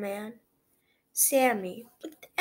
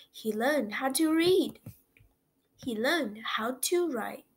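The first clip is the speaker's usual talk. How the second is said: usual speaking voice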